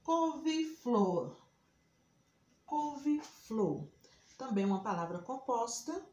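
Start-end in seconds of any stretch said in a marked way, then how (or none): 0.0-3.9 "Couve-flor" raised and drawn out, pause before flor; slow dictation. Shown as a demonstration. Teacher giving another example, "Couve-flor" slowly dictated. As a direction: stretch "Couve-flor" high and very slow, in two halves. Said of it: "Se eu falo assim".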